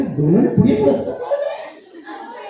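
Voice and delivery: high, laughing incredulous teacher; teacher hearing the same excuse twice, disbelieving; mock disbelief. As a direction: play the teacher who can't believe it.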